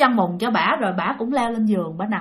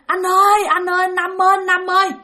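calling out; 7 semitones higher than usual. Retold or imitated. Girl runs home crying, calls brother.

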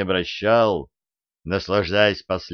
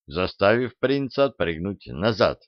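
plain reading voice

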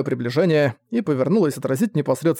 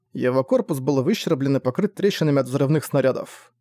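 usual speaking voice

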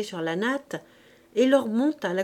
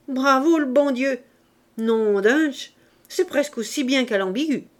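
louder, bold urchin cheering the thunder; thrilled street-kid bravado; Gavroche delighted; 3 semitones higher, 7 dB louder than usual. A narrator's reading. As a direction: revel in the storm, loud and cocky.

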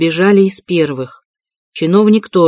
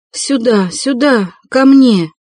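pleading; 6 semitones higher, slower than usual. A narrator's reading. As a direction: beg them to carry her home. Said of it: "умоляла Соня".

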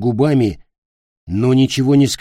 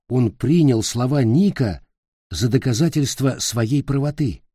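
usual speaking voice

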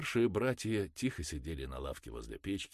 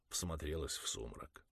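plain reading voice

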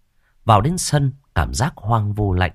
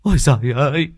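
high, louder sobbing cry; tearful wail; 6 semitones higher, 4 dB louder than usual. Acted. Youngest son collapsed on the ground, tearful high wail. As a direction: wail it out with a crumpling voice. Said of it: "mếu máo".

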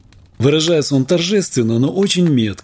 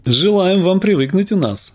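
bright welcome; 7 semitones higher than usual. Acted. Midwife welcoming the doctor.

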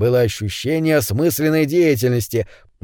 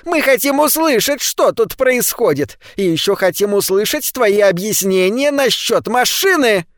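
shrill command; 8 semitones higher than usual. A narrator's reading. Charlie ordering Ned to explain, shrill and commanding. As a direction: bark at Rosen like a petty boss. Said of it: "своим визгливым голосом".

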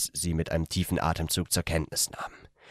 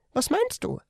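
much higher startled question; nervous young man. A narrator's reading. The young man asks her, nervous and much higher. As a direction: ask it nervously. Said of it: "Ich lachte nervös".

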